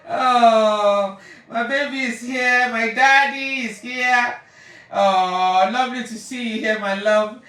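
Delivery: loud, drawn-out, beaming welcome; loud loving greeting. Real, not acted. Welcoming her father into the stream with loud affection. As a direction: pour out love to him, loudly.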